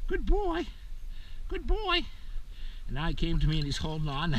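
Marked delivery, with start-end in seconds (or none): 0.1-2.1 high, soft praise for the dog; gentle praise. Real, not acted. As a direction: coo at the puppy.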